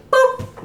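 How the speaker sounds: short unvoiced boop noise; imitated boop. Showing his projector prank mid-lecture, imitated boop. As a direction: pop out a little electronic blip.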